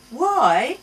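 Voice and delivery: put-on high, loud child's why; insistent child. A narrator's reading. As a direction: demand it like a pestering toddler.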